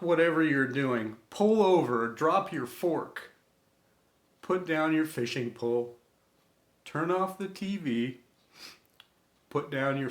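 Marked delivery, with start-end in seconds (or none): none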